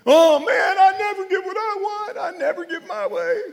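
high, loud sulking whine; hammed-up pouting husband; sulky husband turned down by wife; whiny husband. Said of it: "Oh, not tonight".

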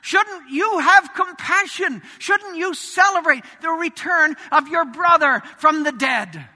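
father entreating his bitter son; pleading father. Retold or imitated. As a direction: beg your son to come in gently but urgently. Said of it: "his father pleads with him".

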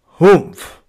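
loud dismissive grunt; grumpy humph. Acted. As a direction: huff it out like a sulky camel. Camel laughs off the three.

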